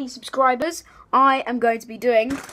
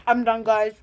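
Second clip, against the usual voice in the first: mouth full. Real, not acted. Giving up, mouth still full.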